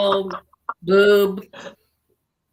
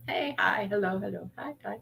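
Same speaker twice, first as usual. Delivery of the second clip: whispering; 10 dB quieter than usual. Real, not acted. Greeting chatters without interrupting them.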